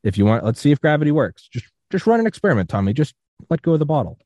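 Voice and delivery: animated, high-pitched retold urging; Charlie Duke. Acting out guest urging an experiment.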